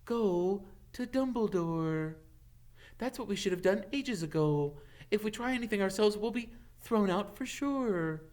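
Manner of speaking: lightly voiced bossy schoolgirl; Hermione. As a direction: insist on the safe choice.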